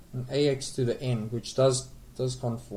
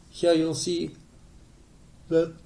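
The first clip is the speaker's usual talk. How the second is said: yawning; 4 semitones higher than usual. Real, not acted. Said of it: "I'm yawning now".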